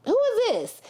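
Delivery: high, sharp "who is this"; mock outrage, voice jumping up; mock startled. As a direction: play a shocked onlooker. Imagined reaction at odd moment.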